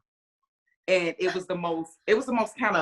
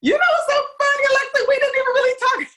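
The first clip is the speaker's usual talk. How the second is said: much higher and louder, laughing and teary; breaking into laughter, moved; laughing through emotion; 9 dB louder than usual. Real, not acted. Proud mom answering the host, laughing and teary.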